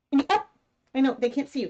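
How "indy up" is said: higher rising call to the dog; coaxing dog. Real, not acted. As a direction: coax the dog up with a lift.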